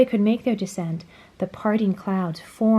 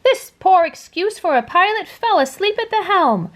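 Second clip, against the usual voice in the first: high, loud angry bark; gruff bug barking blame; barking grasshopper; 10 semitones higher, 5 dB louder than usual. A narrator's reading. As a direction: snap at the sleeping pilot. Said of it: "barked the grasshopper".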